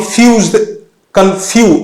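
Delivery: Fuse and কনফিউ with ফিউ pushed hard; stress on FIU. Shown as a demonstration. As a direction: punch the syllable ফিউ. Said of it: "F এর ওপরে আমি stress দিচ্ছি".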